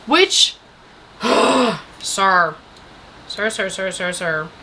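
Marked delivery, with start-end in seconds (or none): 1.2-1.9 loud huff of exasperation; exasperated sigh. Real, not acted. Vlogger fed up with a film character, exasperated sigh. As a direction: let out a heavy breath.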